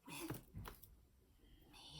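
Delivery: little quiet mouse voice, whispered; hushed, very soft inside voice. Shown as a demonstration. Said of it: "Let's practice our whisper".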